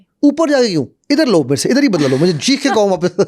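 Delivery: retold shopkeeper yelling, half laughing; mock shout. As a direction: taunt him to shout back right here. Shopkeeper challenging him to shout back.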